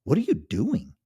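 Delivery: high, loud startled shout; a sudden sharp outcry at her husband. Acted. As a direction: snap the question out in alarm. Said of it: "She shouted".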